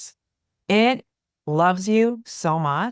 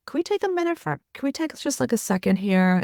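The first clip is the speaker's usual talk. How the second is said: gentle interruption; 8 semitones higher than usual. Retold or imitated. Therapist gently interrupting client.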